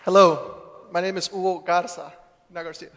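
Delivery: ordinary delivery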